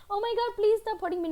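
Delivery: high-pitched helpless victim voice; hammed-up polite begging; mock pleading.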